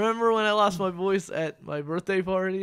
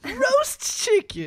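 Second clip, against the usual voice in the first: high drawn-out voice; much slower than usual. Pretend. Mock repeats chicken, high slow.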